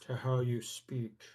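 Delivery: deliberate British villain drawl; Alan Rickman. Shown as a demonstration. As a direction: stretch each word in a slow drawl. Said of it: "have a slow cadence".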